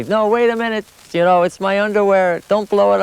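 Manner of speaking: shouting to soldiers about to blow the bag; urgent call. Retold or imitated. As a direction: call out to stop them. Rushing out to stop soldiers.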